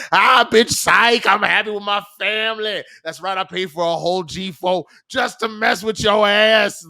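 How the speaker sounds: loud, high mocking revenge reveal; gloating shout. Man at airport taunting his ex, gloating shout. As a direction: yell it like a triumphant gotcha, hammed up.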